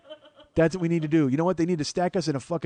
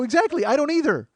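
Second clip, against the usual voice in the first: loud, high-pitched mock exasperation; indignant retort; 11 semitones higher, 6 dB louder than usual. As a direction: fire back as if affronted. Retold indignant retort to seatmate.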